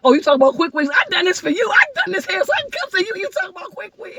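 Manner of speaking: high-pitched playful protest, climbing to a squeal; retold excited fan. Retelling her words to the comedian, high excited squeal. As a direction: act out pleading with your idol, voice shooting up. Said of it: "I was like".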